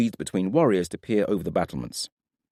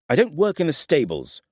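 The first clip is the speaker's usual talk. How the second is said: sharp, raised snap at the stranger; snapped; 10 semitones higher, 4 dB louder than usual. A narrator's reading. Brennan snapping at Nathaire about the stables, sharp and raised. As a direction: bite the words off, irritated. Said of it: "Brennan snapped".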